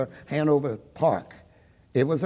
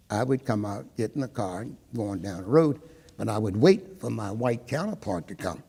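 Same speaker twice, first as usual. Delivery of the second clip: normal talking voice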